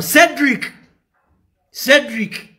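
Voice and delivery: calls Cedric out in anger; calling out angrily. Real, not acted. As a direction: yell his name across the room. Calling out rival's name.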